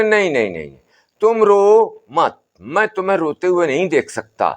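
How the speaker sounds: a hint of an old sadhu calming the family; Baba's voice. बाबा का संवाद. (बाबा की हल्की ऊँची, समझाती आवाज़)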